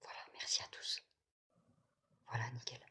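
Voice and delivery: soft unvoiced whisper. Real, not acted. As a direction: whisper the thanks under your breath. Whispered thanks to viewers.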